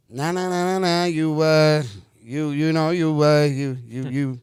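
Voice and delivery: loud, halting imitation of a fumbling talker. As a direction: search loudly for words, stuck on repeats. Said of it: "And he goes".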